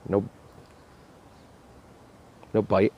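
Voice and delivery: usual speaking voice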